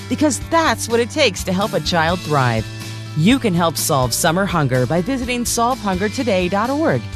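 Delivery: usual speaking voice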